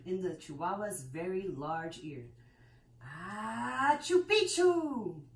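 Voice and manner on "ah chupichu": drawn-out high sneeze-word, much louder; half sneeze, half word, stretched slow; sneezy password. A narrator's reading. Dog sneezes the password into an ear, high and slow.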